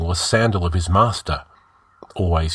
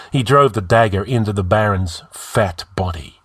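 plain reading voice

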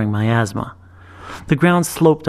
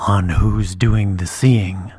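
put-on slow, lower, measured old dragon; 4 semitones lower, much slower than usual. A narrator's reading. Dragon praising the girl's sight, slow and low. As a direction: speak like a wise patient beast.

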